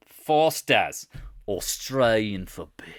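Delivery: mock Australian beer-ad slogan; drawn-out put-on Australian accent. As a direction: announce it slowly in Aussie tones. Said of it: "in an Australian accent".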